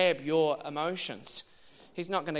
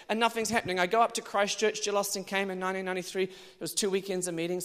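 ordinary talk, nothing marked

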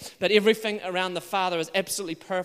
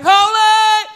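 a much higher, much louder ringing shout of holy; worship cry; 13 semitones higher, 14 dB louder than usual. Shown as a demonstration. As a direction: belt holy out in awe.

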